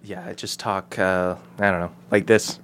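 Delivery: low, hushed put-on lady voice; whispery lady voice. Shown as a demonstration. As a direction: demonstrate a lady voice by nearly whispering. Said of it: "lady voices are, like, whisper".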